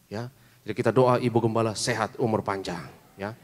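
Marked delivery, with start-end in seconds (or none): none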